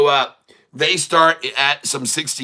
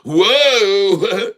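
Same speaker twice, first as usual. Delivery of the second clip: rising, louder, wide-eyed whoa; excited whoa; 6 semitones higher, 4 dB louder than usual. Retold or imitated. Virgin boy thrilled by the kiss, excited whoa. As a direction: let the whoa climb higher in delight. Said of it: "he's like".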